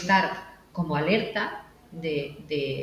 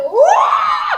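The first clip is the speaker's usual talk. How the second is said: high, loud excited whoop; joyful kid whoop; 12 dB louder than usual. Retold or imitated. Host imitates the jumping third-place kid, high whoop.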